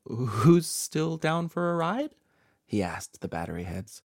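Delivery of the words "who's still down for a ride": drawn-out nervous question, higher and louder. A narrator's reading. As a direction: ask it slowly with uneasy cheer, voice climbing. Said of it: "Patrick chuckled nervously".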